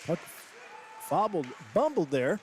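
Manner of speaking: ordinary talk, nothing marked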